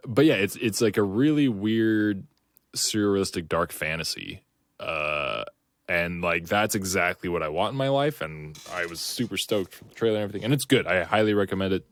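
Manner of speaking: usual speaking voice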